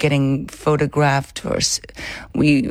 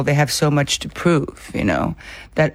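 ordinary talk, nothing marked

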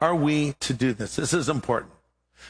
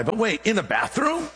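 higher-pitched retold disbelief; incredulous; 5 semitones higher than usual. Preacher questioning himself about witnessing in a bathroom, incredulous. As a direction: question it in a raised, doubting pitch.

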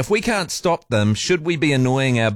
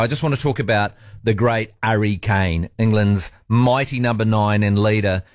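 ordinary delivery

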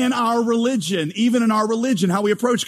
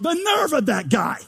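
mock indignant; 7 semitones higher than usual. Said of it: "we're like".

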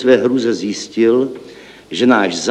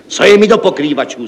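higher, louder impatient outburst; exclaimed; 7 semitones higher, 5 dB louder than usual. A narrator's reading. Director snapping at the clerk's deputy, exclaiming. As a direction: brush the roofers off with a shout. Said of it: "zvolal podnikový ředitel".

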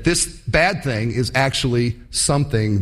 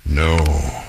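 much lower, drawn-out dismayed "oh no"; doomed fish; 8 semitones lower, much slower than usual. Acted. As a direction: groan it like a hooked fish realizing too late. Hooked bass realizes its doom.

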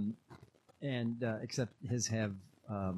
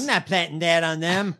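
much louder, put-on refusing voice; mock indignant shout; 5 semitones higher, 10 dB louder than usual. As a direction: bellow it like a man refusing the job.

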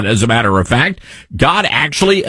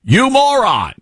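high, indignant outburst; exasperated insult; 7 semitones higher than usual. Real, not acted. Host rebuking the news anchor, exasperated. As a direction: fling the insult out, pitched up.

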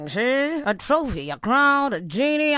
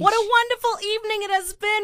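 gushing gala host; 11 semitones higher than usual. Acted. Host voicing gala presenter.

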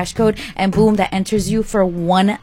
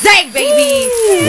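hype announcer; 13 semitones higher, much slower than usual. Acted. Hyping the cash giveaway.

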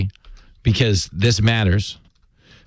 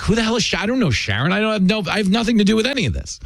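higher, fast flustered denial; alarmed protest; 8 semitones higher, much faster than usual. Retold or imitated. Host retelling his panic over Sharon, higher and flustered. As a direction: play it like a man caught off guard, backing away. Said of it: "I'm like".

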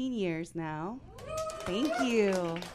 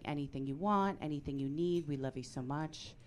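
soft, low, tender reassurance; supportive; 4 semitones lower, 4 dB quieter than usual. Retold or imitated. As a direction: imitate a gently doting parent.

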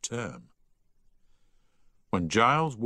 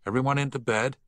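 higher, mildly firm order; 7 semitones higher, 3 dB louder, faster than usual. A narrator's reading. Prefect ordering the dormitory to bed, firmly. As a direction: give a firm bedtime order. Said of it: "he said firmly".

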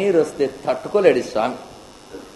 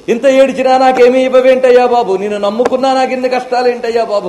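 higher and louder whining grievance; mock complaint; 6 semitones higher, 6 dB louder than usual. A preacher mocking devotees who demand rewards, whiny and loud. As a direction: complain to God like a petty devotee. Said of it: "అని అడగలేదు".

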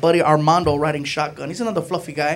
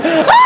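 high panicked scream; 11 semitones higher, 7 dB louder than usual. Retold or imitated. Freaking out retold.

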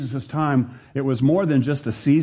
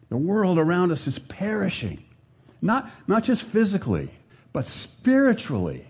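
fervent preaching; 4 semitones higher than usual. Acted. Preacher building to high fervor.

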